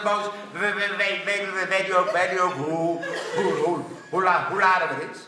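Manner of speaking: stammering man; stutter. Acted. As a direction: stutter and repeat every word.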